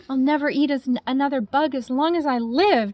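put-on young bat cheering after a fruit feast. A narrator's reading. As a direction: cheer it with a full belly. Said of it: "cheered Stella Luna".

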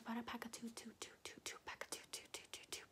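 rapid whispered word repetition; quick aggressive whispered triggers; fast whispering asmr. Acted.

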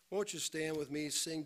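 normal talking voice

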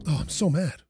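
usual speaking voice

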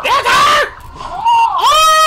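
high startled scream; panicking gamer. Real, not acted. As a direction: shriek on seeing her. Spots the killer, screams.

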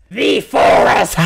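big, slow shouted proclamation; drawn-out hammy yell; mock-dramatic shout. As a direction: bellow it like a booming banishment. Mock-dramatic send-off announcement.

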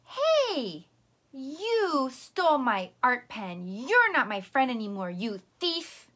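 loud, hammed-up yelling accusation; angry yell. Shown as a demonstration. Teacher yells at the puppet Cloud for taking her art pen, angry yell. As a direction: shout the accusation like a hurt, furious kid. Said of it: "when I yelled at Cloud".

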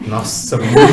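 teasing through a laugh; laughing tease. Real, not acted. Host teasing the oldest candidate, laughing. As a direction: joke it out while laughing.

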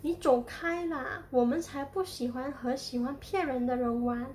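usual speaking voice

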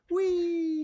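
high, loud squeal of joy; gleeful shriek. A narrator's reading. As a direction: shriek with delight down a slide. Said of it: "she shrieked".

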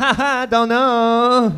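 mock Chinese accent. Driver laughing off the cop, mock Chinese accent. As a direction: giggle and play dumb in a Chinese accent. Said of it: "then they turned Chinese".

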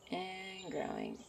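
a quiet drawn-out "And growing.". Acted. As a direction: let "And growing." fade away slowly and softly. Watching the flower grow.

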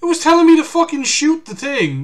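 high-pitched aggrieved complaining; whining at the unfair game; frustrated rant. Real, not acted. Player complaining to chat after dying again, frustrated rant.

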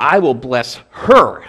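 "her" leaned on, slow and firm; stress on her. Shown as a demonstration. Preacher stressing "her" to his congregation. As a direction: punch the word "her" in "I will bless her". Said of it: "there's an emphasis on her".

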